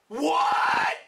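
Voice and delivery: high loud incredulous yell; shocked cry. Real, not acted. Reviewer stunned by the twist, crying shout. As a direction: cry out in disbelief. Disbelief outburst.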